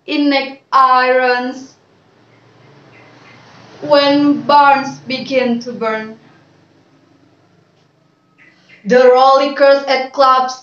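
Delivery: slow, declaimed poem with long pauses; poem recitation. Acted. Student reciting a poem for a video, measured declamation. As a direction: recite it line by line, deliberately and clearly.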